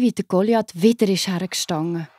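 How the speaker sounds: plain reading voice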